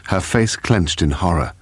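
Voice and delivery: ordinary delivery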